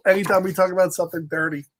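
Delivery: ordinary delivery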